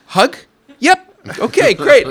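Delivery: high and loud, delighted; bubbly, overjoyed back-and-forth; squealing happy dad and kid; excited retold exchange. Hug exchange with daughter.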